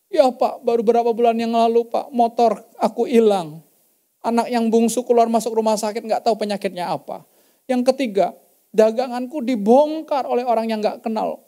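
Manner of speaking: wife telling the pastor her troubles; wife's lament. Retold or imitated. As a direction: pour out the misfortunes to the pastor. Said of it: "istrinya bilang".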